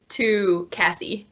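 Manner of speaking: ordinary talk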